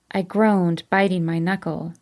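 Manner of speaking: ordinary delivery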